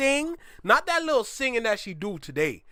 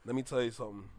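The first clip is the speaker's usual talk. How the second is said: even deeper and softer, grave; lower, hushed; 7 semitones lower, 10 dB quieter, much faster than usual. Real, not acted. Repeating the point to the artist, lower and hushed. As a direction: quieter still for weight.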